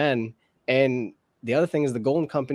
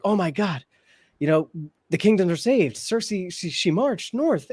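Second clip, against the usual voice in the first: higher-pitched imitation of relieved onlookers; mock amazement; 6 semitones higher than usual. Imagined enemies hailing Cersei's march north, in mock amazement. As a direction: play their astonished relief, pitched up.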